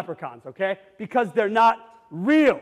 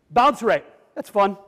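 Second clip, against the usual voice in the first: ordinary delivery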